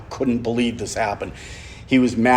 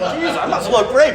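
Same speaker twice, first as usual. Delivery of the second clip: amused self-mock; 3 dB louder than usual. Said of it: "I was like".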